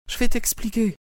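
very high woman's voice, firm; wife's voice. Acted. Wife entering the room answering her husband, high firm. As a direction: step in and take charge, voice high.

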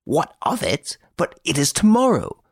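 high, agitated echo of the question; an old nobleman, outraged that nobody cares; high-pitched, exasperated disbelief; indignant Baron. Acted.

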